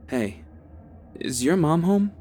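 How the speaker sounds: casual. Acted. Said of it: "he said casually".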